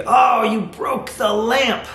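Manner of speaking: high, drawn-out mock shout; emotional exclamation. Shown as a demonstration. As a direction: cry out slowly in exaggerated upset. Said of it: "you're shouting, essentially".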